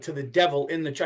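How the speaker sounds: ordinary delivery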